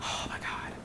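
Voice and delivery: breathless whisper of amazement; stunned hushed whisper to herself; awed whisper. Real, not acted. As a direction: whisper it as if overwhelmed. Overwhelmed reader, gasping aside.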